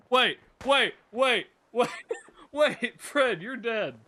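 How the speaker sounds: high, loud repeated double-takes; real amused shock, laughing through it; startled disbelief. Real, not acted. Gamer seeing dead Fred standing there, startled disbelief. Finds dead character alive in game.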